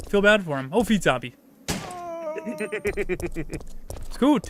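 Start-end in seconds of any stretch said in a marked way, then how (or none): 1.7-4.1 high laugh. Real, not acted.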